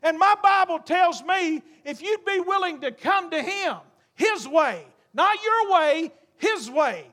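full force preaching. Acted. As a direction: preach it out with rising fire. Preacher builds to altar call.

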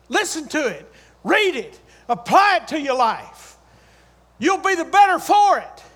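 punchy sermon commands with pauses between; emphatic preaching. Real, not acted. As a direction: exhort the congregation in short, forceful bursts. Preaches at full force.

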